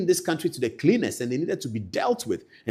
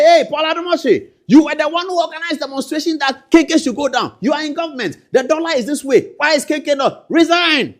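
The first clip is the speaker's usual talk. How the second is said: higher, louder mocking crowd voice; mock heckler; 12 semitones higher, 7 dB louder than usual. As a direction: shout down the official like a jeering crowd. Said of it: "And they'll say".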